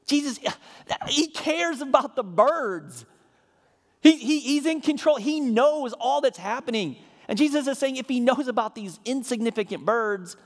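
high and loud, slowed for weight; impassioned preaching. Acted. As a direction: press God's care hard on the church. Preacher rises in passion.

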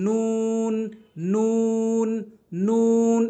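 long, high, rounded "নুন"; নুন with rounded mouth. Shown as a demonstration. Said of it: "এভাবে আমরা উচ্চারণ করব". ("নুন", মুখ গোল করে টেনে)

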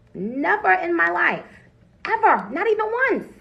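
NEVER and EVER pitched high and loud; emphatic denial. Real, not acted. Swearing to viewers about the drug, emphatic high, loud NEVER and EVER.

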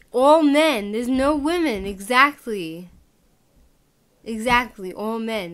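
heated shouting rant; loud rant. Real, not acted. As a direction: hammer the point home. Ranting loudly at video.

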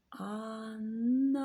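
Ана sung slowly, the final А held; drawn-out syllables. Shown as a demonstration. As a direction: stretch Ана, holding the last А. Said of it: "звуковий аналіз слова". (вчителька протяжно тягне Ана)

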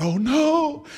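high, loud panicked oh no; sudden alarm, voice jumps up; panicked cry. Retold or imitated. Foot stuck before crocodile.

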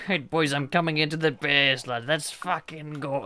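loud, high Irish rallying cry; Felix doing Irish. Retold or imitated. Imitating a friend flying into base, loud Irish bit. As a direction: holler to the lads in a hammed-up Irish accent. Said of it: "then he goes Irish".

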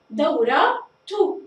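دورة تو said slowly, the final ة sounded as a clear tu; slow, stretched demo of دورة تو; taa marbuta voiced. Shown as a demonstration. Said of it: "ونلاحظ بنطقها". (نطق دورة تو ببطء مع إظهار التاء المضمومة)

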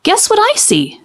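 put-on a young brother teasing with a riddle; lightly voiced young boy. A narrator's reading. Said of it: "said Clark".

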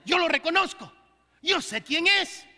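lightly voiced demon, knowing; demon recognising. Preacher voicing demon who spots Christ, lightly voiced. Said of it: "Y aquí un demonio se da cuenta".

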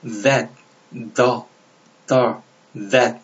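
That and the with a d-like th; voiced th demonstration. Shown as a demonstration. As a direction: say That and the one by one, th close to d. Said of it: "디귿 비슷한 발음이 있죠".

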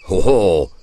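loud affronted exclamation; indignant. A narrator's reading. Peasant François hearing the child's threat, indignant. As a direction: protest loudly, taken aback. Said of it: "dit François indigné".

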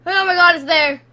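loud burst of fright; loud, startled sighting; alarmed cry. Real, not acted. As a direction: yell it in sudden alarm. Spots the threat.

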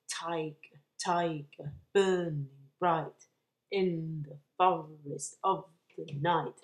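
"Tiger, tiger, burning bright" pounded out with every stressed syllable hit hard; exaggerated rhythm. Shown as a demonstration. Teacher showing the poem's meter, exaggerated beat on "Tiger, tiger, burning bright". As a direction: chant "Tiger, tiger, burning bright" in heavy STRESSED-unstressed beats, leaning on TI, BURN, BRIGHT. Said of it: "if I exaggerate the rhythm".

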